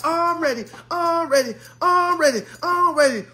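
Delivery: eager, high chant of the same words; SpongeBob. Retold or imitated. Jimmy leaving therapy, hyped like SpongeBob. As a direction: pump yourself up like a cartoon sponge. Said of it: "feeling like SpongeBob".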